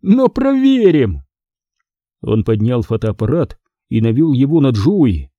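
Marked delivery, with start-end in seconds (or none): 0.0-1.2 slow, high, teasing threat; a bully teasing as he raises the camera, high and slow; mocking. A narrator's reading. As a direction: drag it out to taunt him. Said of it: "насмешливо сказал Микки".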